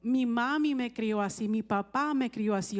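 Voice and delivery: usual speaking voice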